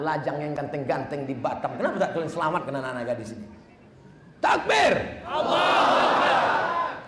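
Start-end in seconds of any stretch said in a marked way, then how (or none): 4.4-7.1 takbir call. Real, not acted. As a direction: call the crowd with full voice. Calling out the takbir.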